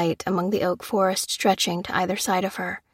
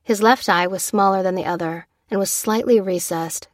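ordinary delivery

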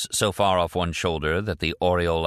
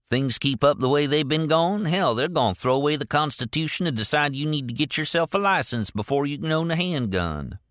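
raised, gruff rural voice; gruff Southern man; 9 semitones higher than usual. A narrator's reading. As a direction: play the old bigot. Leroy's voice, narrated.

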